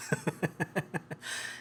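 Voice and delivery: soft breathless laugh; airy giggle; breathy laugh. Real, not acted. Laughs at child.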